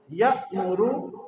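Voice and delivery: یأمرو with a sharp glottal catch, drawn out slowly; slow Quranic reading of یأمرو, hamza jerked; recitation demo. Shown as a demonstration. Quran teacher demonstrates reading for students. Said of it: "پڑھیں ذرا".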